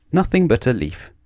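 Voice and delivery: ordinary delivery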